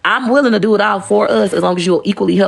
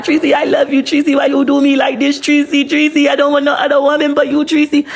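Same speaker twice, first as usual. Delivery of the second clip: higher, whiny mock pleading; mock crying husband; 7 semitones higher than usual. Stepdad on the bus, mock crying for Tracy. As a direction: imitate a crying man begging Tracy.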